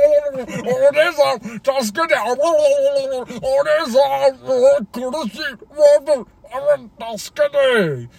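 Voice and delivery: high squeaky slipping and drowning cries; cartoon puppet voice. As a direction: play a puppet falling in water.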